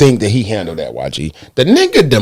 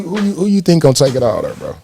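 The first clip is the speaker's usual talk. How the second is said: normal talking voice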